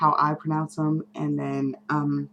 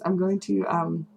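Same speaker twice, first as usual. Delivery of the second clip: ordinary talk, nothing marked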